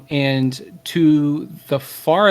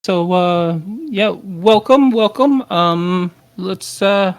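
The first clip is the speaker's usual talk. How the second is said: higher, lifted voice, drawn-out welcome; hearty host welcome; 5 semitones higher, slower than usual. Acted. Nos greets hungry guests in her town, hearty host welcome.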